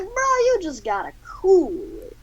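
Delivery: high-pitched swaggering caricature; comic high-voiced reprimand; Samuel L. Jackson. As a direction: tell him off in a high put-on voice. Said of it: "Samuel L. Jackson is just like".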